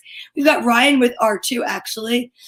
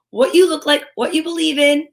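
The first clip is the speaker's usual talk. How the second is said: ordinary delivery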